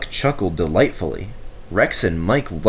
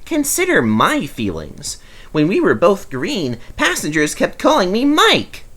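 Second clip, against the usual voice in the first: teasing; 10 semitones higher than usual. A narrator's reading. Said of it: "winked at Duck".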